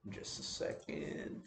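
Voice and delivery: a quick unvoiced whisper off-mic; hushed muttered aside. Real, not acted. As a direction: breathe it aside as you fix something. Aside while fixing recording.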